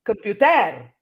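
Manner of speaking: "computer" as kum-pyoo-TER; end-stressed "computer"; last syllable stressed. Shown as a demonstration. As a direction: lift the final TER of "computer". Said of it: "or you say".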